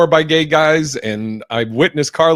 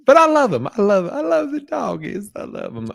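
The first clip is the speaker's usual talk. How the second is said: gushing over doggies; 9 semitones higher than usual. Acted. Host gushing about how much he loves the doggies, high gushing voice. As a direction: coo it up high, almost whining with love.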